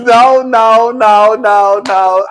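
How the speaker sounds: loud string of insistent no's; loud objection. Real, not acted. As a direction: shut the argument down at volume. Cutting off those denying the truth.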